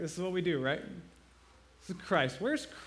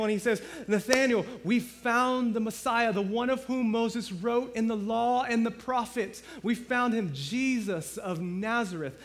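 higher and louder, bursting with news; excited announcement; 5 semitones higher, 5 dB louder than usual. Retold or imitated. Philip rushing to tell Nathaniel, excited and loud. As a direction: tell your friend you found him. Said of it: "he goes very excitedly to Nathaniel".

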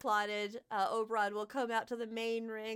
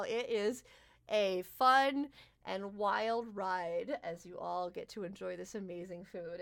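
ordinary talk, nothing marked

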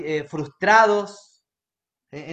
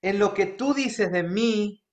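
declaring prayer. Acted. Preacher leads congregation declaring to God, earnest. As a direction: lead the church in a spoken prayer. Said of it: "quiero que declares esto conmigo".

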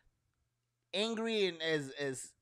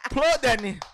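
playful loud call-out; 7 semitones higher, 7 dB louder than usual. Real, not acted.

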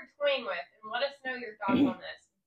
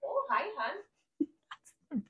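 very drawn-out, higher Minnesotan greeting; Minnesota accent; 4 semitones higher, much slower than usual. Shown as a demonstration. Cohost demonstrating for her friend, Minnesota accent. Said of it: "I can do a Minnesota accent pretty good".

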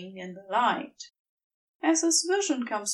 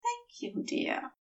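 soft, breathy-quiet thanks, higher voice for the angel; murmured; 14 semitones higher, 8 dB quieter than usual. A narrator's reading. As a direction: murmur it tenderly, almost under the breath. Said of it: "Aziraphale murmurs".

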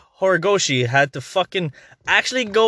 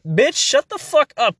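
high-pitched, drawn-out irritated dismissal; fed-up clapback at the haters; exasperated retort; 8 semitones higher, slower than usual. Acted. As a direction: tell them off, slowly and high. Exasperated retort to cancel demands.

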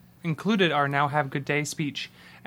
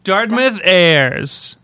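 big drawn-out announcement; announcer voice; 4 semitones higher, 10 dB louder, much slower than usual. Acted. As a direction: boom it out slowly like an emcee. Announcing the visiting group.

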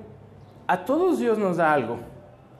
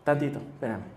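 quiet aside; 6 semitones lower, 10 dB quieter than usual. Acted. [name] excuses himself to give thanks.

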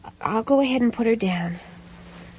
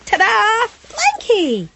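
cheerful reveal; 8 semitones higher, much slower than usual. Acted. Mother presents blanket to boy.

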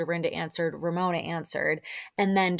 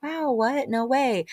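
high, raised imitation of amazed friends; mock amazement; 6 semitones higher, slower than usual. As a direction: react as the amazed ladies would. Voicing the ladies' amazed reaction.

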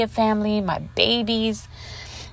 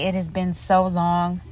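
ordinary talk

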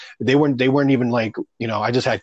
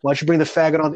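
mock taunting host; 6 semitones higher, 5 dB louder, faster than usual. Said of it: "the host of the show goes".

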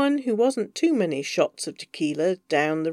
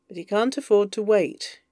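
ordinary delivery, nothing marked

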